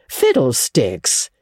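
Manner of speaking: slow, drawn-out scoff; scornful dismissal. Acted. Marilla dismisses ghosts, a slow scoff.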